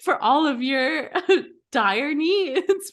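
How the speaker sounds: hammed-up product pitch through laughter; mock ad read. Contestant pitching soup to the camera, mock ad read.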